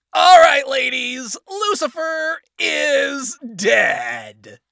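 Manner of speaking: loud, high, drawn-out victory cry; rally the troops; triumphant shout. Acted. Announces to the army.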